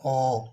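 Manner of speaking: ओ held long like a triple o; vowel demo. Shown as a demonstration. Teacher showing the long o sound, drawn out. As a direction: stretch ओ into one long sound. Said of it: "triple ओ के बराबर है".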